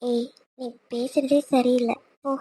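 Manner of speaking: soft, slow bashful scolding; shy. Acted. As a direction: push him off coyly. Wife shy at flirting.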